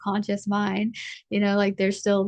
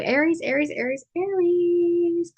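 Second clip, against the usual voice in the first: drawn-out sing-song call, higher; summoning her Aries viewers; 8 semitones higher than usual. Real, not acted. Reader to her audience, calling out Aries.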